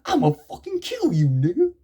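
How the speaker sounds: high, mock-furious threat in a different voice; angry gangster threat. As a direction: shriek the threat like an enraged thug. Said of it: "this guy is like".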